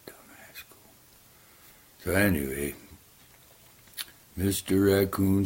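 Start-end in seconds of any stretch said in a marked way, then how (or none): 0.1-0.8 whispered sneer, much softer; under his breath. Acted. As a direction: mutter it gloating under your breath. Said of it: "laughing under his breath".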